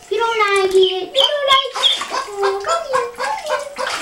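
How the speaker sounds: high, softening coo. Real, not acted. Cooing at the ducklings.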